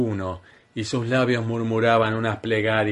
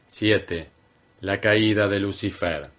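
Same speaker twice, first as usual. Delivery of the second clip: ordinary delivery, nothing marked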